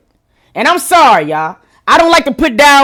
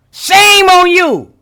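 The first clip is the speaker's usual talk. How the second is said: slow, higher shaming drawl; slow, higher, drawn-out mock scold; 6 semitones higher, 4 dB louder, much slower than usual. Real, not acted. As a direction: stretch it out like a disappointed auntie. Scolding Mariah, repeated stronger.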